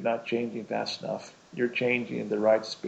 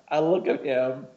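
ordinary talk

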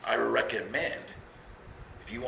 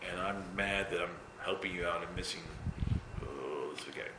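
mock sulk; 5 semitones lower, 9 dB quieter than usual. Mock sulking about spaghetti.

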